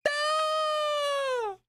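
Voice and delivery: sudden high yelled "Ah!"; startled yell at the answer; high shout. Real, not acted. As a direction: burst out with a sharp high yell. Yelling at reveal.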